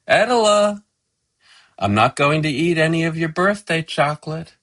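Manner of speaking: high, loud call to her husband. A narrator's reading. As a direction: call the name out, then announce it quickly. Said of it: "she says out loud".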